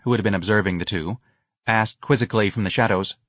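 plain reading voice

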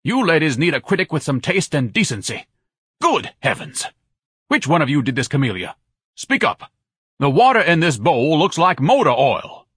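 high, loud indignant rant; ranting critic. A narrator's reading. Ignatius rants at the ladies about their camellia, outraged. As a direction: demand answers, outraged.

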